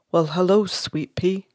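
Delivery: lightly voiced baritone father, softly; deep-voiced Texan dad. Medium trying out a dead father's greeting to his daughter, deep-voiced. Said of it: "That's a deep voice".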